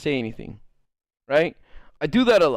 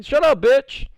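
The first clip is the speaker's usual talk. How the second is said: high, loud mock snap; squeaky rude brush-off; 9 semitones higher, 4 dB louder, slower than usual. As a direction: bite back in a squeaky voice. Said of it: "And you go".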